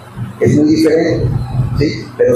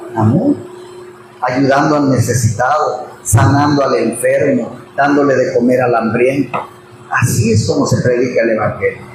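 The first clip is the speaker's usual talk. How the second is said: sincere lower answer; 6 semitones lower than usual. Real, not acted. Preacher answers his own question.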